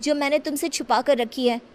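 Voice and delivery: put-on a girl pleading innocently with her elder; young girl voice. Young Shakti questioning her guardian, curious and high.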